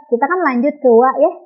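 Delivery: normal talking voice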